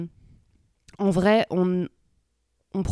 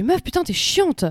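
higher, exasperated, a bit drawn out; exasperated outburst; 10 semitones higher, slower than usual. Retold or imitated. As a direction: let the annoyance burst out at the colleague. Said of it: "genre, ça me saoule".

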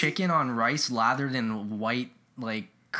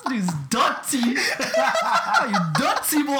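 high, laughing mock Jamaican accent; 10 semitones higher, 5 dB louder, slower than usual. Friend teasing the cook in a hammed-up Jamaican accent. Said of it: "such a fun accent".

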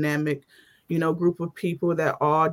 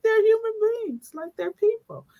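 exasperated quote. Retold or imitated. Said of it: "I'm like".

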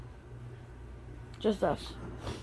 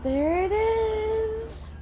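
excited; 9 semitones higher, much slower than usual. Real, not acted. Waiting for kids' bus, excited.

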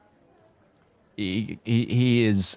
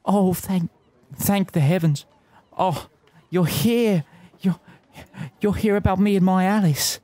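put-on higher, lovesick young man in a Kiwi accent; 7 semitones higher than usual. GM as Gavin telling the party about Alice, pleading Kiwi voice. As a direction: pour out his heart in a New Zealand lilt. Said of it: "the Kiwi that this guy has become".

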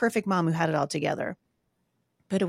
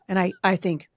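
normal talking voice

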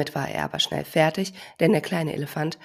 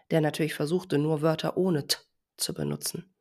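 ordinary delivery, nothing marked

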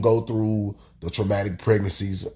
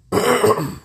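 throat clearing; 14 semitones higher, 5 dB louder than usual. Real, not acted. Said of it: "I'm dealing with covid nineteen".

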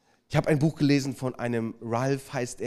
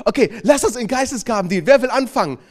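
higher, louder, faster eager call; euphoric; 8 semitones higher, 9 dB louder than usual. Retold or imitated. Group leader eagerly urging the small group to start, loud and excited. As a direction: pump up a sleepy group. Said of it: "richtig euphorisch".

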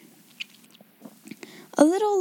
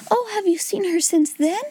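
eager exclaim; 7 semitones higher than usual. A narrator's reading. Sarah asking the bun woman about the girl, higher and eager. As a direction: burst out hopefully. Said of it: "exclaimed Sarah".

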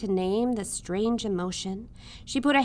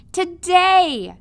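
much higher and louder shout; 9 semitones higher, 12 dB louder than usual. A narrator's reading. Midge shouting to the royals already too far away. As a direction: shout it after them. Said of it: "shouted Midge".

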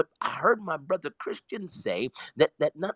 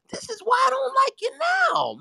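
much higher, fast, laughing exasperation; excited shout; faster than usual. Real, not acted. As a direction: playfully scold your friend in a high shout.

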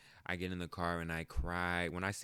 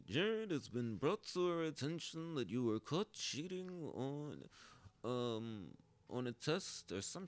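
high, put-on voice of the school official; principal's voice; 6 semitones higher than usual. Mimicking the official who caught him cheating, in a high put-on voice. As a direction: imitate the stern principal reading the charge. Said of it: "And his voice".

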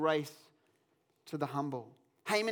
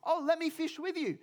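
high-pitched, delighted newcomer; eager fishing guest; 8 semitones higher than usual. Retold or imitated. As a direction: pipe up eagerly, asking to join in. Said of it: "and he goes".

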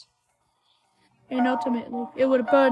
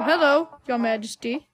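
ordinary talk

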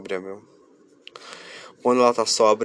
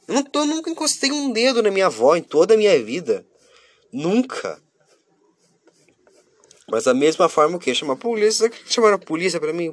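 indignant; 8 semitones higher, 4 dB louder than usual. Real, not acted. Indignant about police called.

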